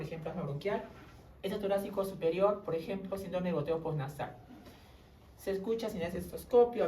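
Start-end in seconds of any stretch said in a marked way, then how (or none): none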